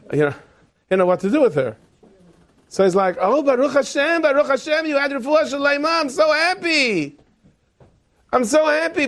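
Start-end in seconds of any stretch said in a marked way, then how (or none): 3.2-9.1 very high, gushing relief; overdone joy. Acted. Father-in-law greeting the unwanted survivor, gushing joy. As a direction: stretch out the happiness in a high put-on voice. Feigned joy at her recovery.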